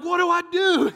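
high, loud hammed-up shriek; helpless yell played for laughs; mock screaming. Preacher acting out his panic among the wasps, mock screaming. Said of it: "I'm screaming".

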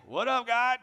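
high, loud, breezy hello to God; casual country greeting. Retold or imitated. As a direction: greet God like an old buddy. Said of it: "I'm all country".

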